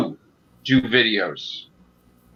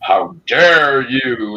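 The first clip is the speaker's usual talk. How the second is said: dark lord; 4 dB louder, much slower than usual. Retold or imitated. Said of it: "you're like".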